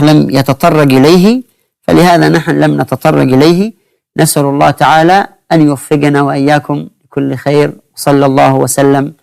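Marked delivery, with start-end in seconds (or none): 4.2-9.1 closing prayer. Acted. (دعاء ختامي بصوت هادئ خافت)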